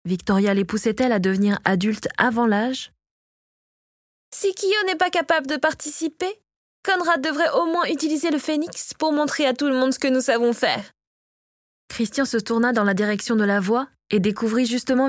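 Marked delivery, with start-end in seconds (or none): 4.3-10.9 higher-pitched angry challenge. A narrator's reading. Victoria, arms crossed, cuts in on her husband, angry. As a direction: reproach him in a higher voice. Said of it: "le regard chargé de colère".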